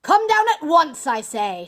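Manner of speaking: loud, high-pitched command; enraged villain shouting; furious shout. A narrator's reading. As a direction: bellow the order impatiently. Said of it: "shouted Bluebeard".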